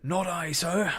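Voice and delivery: prompt, loud denial; Morgan saluting. A narrator's reading. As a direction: answer like a seaman reporting to a superior. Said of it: "said Morgan with a salute".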